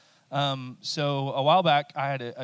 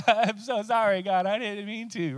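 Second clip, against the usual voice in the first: high, whiny crying plea; crying sinner; 8 semitones higher, 4 dB louder than usual. Pretend. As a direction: play the sorry sinner, whimpering high. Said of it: "He's crying down there".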